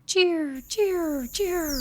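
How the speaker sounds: cardinal call imitation. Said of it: "and cardinals sing".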